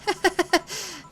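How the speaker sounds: a laugh that escapes despite the mood; high burst of laughter. Acted. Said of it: "Are you laughing?".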